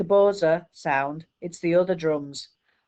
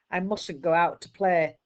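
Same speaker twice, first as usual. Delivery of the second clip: usual speaking voice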